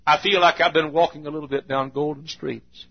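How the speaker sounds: gentle prayer voice; closing prayer. Real, not acted. Preacher praying to the Father at the end, gentle.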